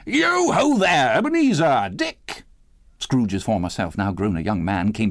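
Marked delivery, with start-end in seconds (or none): 0.1-2.5 rich, oily, high-pitched hearty holler; Fezziwig's jovial call. A narrator's reading. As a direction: call out long and merrily in a fat, comfortable voice. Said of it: "called out in a comfortable, oily, rich, fat, jovial voice".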